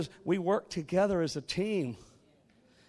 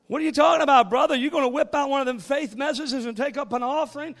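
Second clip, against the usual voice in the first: skeptical churchgoer; 8 semitones higher, 6 dB louder than usual. Retold or imitated. Mocking skeptic in congregation.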